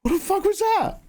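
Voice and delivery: high-pitched stunned disbelief; hammed-up confused abductee after the aliens; mock bewildered. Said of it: "And you're just like".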